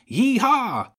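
put-on very high, loud child's whoop; child calling out. A narrator's reading. Child hurling water bombs at cats, high whoop. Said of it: "A child's voice called".

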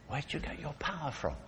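very soft, secretive asking; hushed question. Acted. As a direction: ask it under your breath, wondering. Voicing the question put to Samson.

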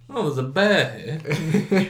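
slowly, mock startled at a bear; mock surprise. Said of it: "I was like".

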